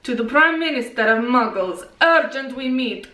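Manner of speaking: put-on Russian accent; mock-formal Russian accent. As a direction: read out the letter as a Russian. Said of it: "Can you do a Russian accent?".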